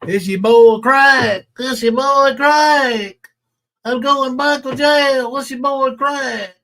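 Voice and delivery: mimicking a streamer's sign-on, slow and loud; mocking Crack's catchphrase. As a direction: lampoon his sign-on with a drawn-out swagger. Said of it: "Crack baby".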